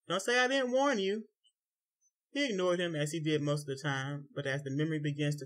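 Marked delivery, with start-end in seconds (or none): 0.1-1.3 called out high and loud from behind; yelled warning. A narrator's reading. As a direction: yell it after him across the room. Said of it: "he could hear him yell out".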